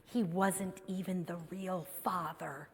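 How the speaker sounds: soft, hushed confiding aside; a quiet, conspiratorial aside; secret. Acted. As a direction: lean in and let the congregation in on a secret. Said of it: "here's a little secret for you".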